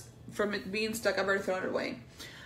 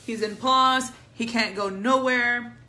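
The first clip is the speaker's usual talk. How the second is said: loud; 3 semitones higher, 8 dB louder than usual. Real, not acted. Joking about paused video character.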